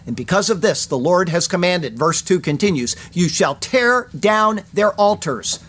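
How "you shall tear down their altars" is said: slow, weighted "tear down"; strong emphasis. Shown as a demonstration. The Lord's command read from verse two, slow and emphatic. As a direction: set "tear" and "down" apart, each one stressed. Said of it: "There's a strong emphasis".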